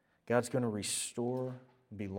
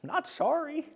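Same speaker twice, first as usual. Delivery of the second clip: pitched up, playfully unapologetic; cheeky defiance; 14 semitones higher than usual. Retold or imitated. Pastor teasing the elders, mock defiant. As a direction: toss it off like a cheeky kid. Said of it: "I think I made all the elders mad".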